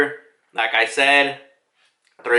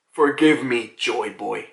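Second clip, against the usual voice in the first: low, solemn giant voice; hushed, low mock-grave apology; ancient giant; 5 semitones lower, slower than usual. Ancient giant's first words acted out.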